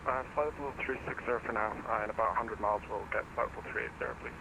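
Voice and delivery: over a pilot headset. Real, not acted. Pilot reading back over radio.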